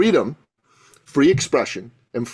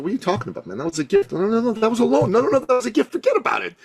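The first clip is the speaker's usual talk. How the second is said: pitched way up, mock indignant dad brushing it off; imitating dad; 7 semitones higher, faster than usual. Dad refusing a friend's repayment, high mock indignation. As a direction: play the generous father scoffing at repayment.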